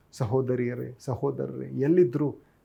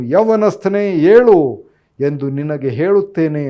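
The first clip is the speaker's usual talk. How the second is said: commanding call; 2 semitones higher, 10 dB louder than usual. Retold or imitated. ಯೇಸು ಸತ್ತ ಯುವಕನಿಗೆ ಆಜ್ಞಾಪಿಸುತ್ತಾ, ಗಟ್ಟಿ ಕೂಗು. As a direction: order the dead youth up. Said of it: "ಅಂದನು".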